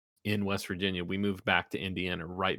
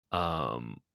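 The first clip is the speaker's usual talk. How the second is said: ordinary talk, nothing marked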